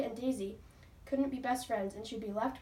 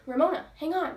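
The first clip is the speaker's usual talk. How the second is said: loud, higher urgent call; 6 semitones higher, 9 dB louder than usual. A narrator's reading. Daisy urges Ramona to hold on, loud call. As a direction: call across to her friend. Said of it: "Daisy called out".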